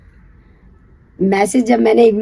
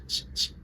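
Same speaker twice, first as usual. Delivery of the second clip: shushing; 20 dB quieter than usual. Retold or imitated. Shushing so parents don't wake.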